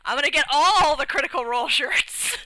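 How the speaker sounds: gushing over a shopping spree to come; excited. Real, not acted.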